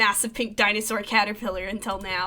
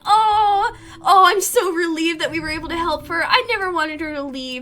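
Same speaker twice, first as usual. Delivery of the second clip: relieved joy; 5 semitones higher, 5 dB louder than usual. Real, not acted. Relieved reaction after rescue.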